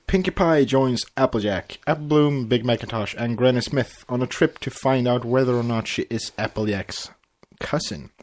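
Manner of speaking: ordinary talk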